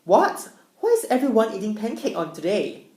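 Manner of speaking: high, loud startled what, then confused question; retelling her surprise with a loud high what; puzzled what. Retold or imitated. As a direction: act baffled by the pancakes. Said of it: "So I'm like".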